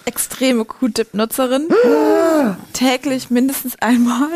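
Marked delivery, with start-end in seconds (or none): 1.7-2.7 high, loud theatrical gasp; mock shocked gasp. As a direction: gasp as if scandalised. Mock horror at Q-Tip confession.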